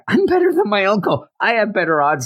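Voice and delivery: high, quick burst of triumph; retold excitement. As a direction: pitch jumps up in mock elation. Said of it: "He's all excited".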